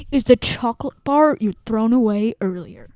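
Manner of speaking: loud, slow dramatic reading; dramatic reveal. Acted. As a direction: boom out the reveal slowly.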